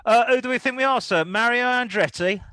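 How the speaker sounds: high, mock-deferential policeman; a copper simpering then scolding; fawning cop. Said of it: "you would have just go".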